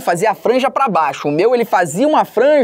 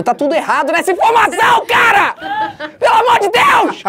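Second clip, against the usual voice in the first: outraged shout; 13 semitones higher than usual. Real, not acted. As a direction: protest it at full volume. Outraged at a wrong caption.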